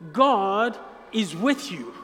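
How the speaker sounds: slow, deliberate God is with you; slow and weighty, heavy on God; slow emphatic repeat. Shown as a demonstration. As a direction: spell it out slowly, leaning on God. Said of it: "So I'm gonna say this again".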